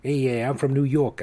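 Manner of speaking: badly faked New York accent; terrible New York accent. Podcast host guessing how a listener talks, in a bad New York accent. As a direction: have a half-hearted go at New York. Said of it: "I can't do a New York accent".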